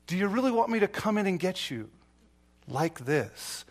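ordinary talk, nothing marked

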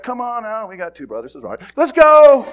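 a brother hurrying the straggler; coaxing brother. Retold or imitated. Brother urging the lagging boy to come, loudly. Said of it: "John Mark's like".

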